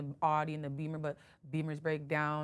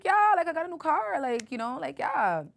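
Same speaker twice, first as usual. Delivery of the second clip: mock excited reply; 9 semitones higher, 6 dB louder than usual. Own past self retold, animated.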